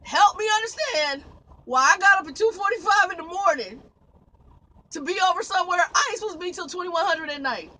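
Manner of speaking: loud, high-pitched pleading demand; exasperated rant. Real, not acted. Trucker protesting a needless early start, exasperated rant. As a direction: demand an explanation at full volume.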